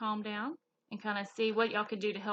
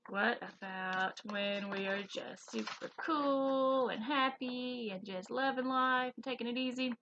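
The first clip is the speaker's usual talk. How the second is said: ordinary delivery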